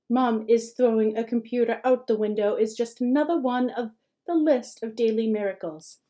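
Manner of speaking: ordinary delivery, nothing marked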